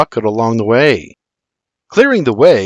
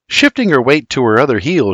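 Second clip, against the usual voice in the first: plain reading voice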